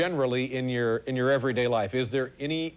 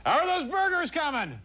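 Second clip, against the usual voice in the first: high called-out question to the kitchen; calling out; 13 semitones higher than usual. Real, not acted. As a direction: call it across the studio, pitched up. Host calls out to kitchen.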